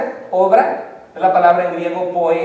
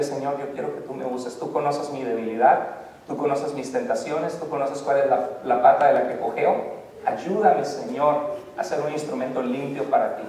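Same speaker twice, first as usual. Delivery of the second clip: softer, lower, intimate plea to God; humble prayer; 5 semitones lower, 6 dB quieter than usual. Acted. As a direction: pray it quietly, from the heart. Prayer modelled for congregation.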